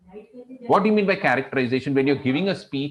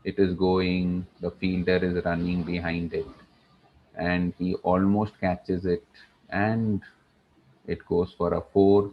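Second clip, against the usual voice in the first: no thrill; 6 semitones lower than usual. Shown as a demonstration. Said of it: "because there was no action thrill".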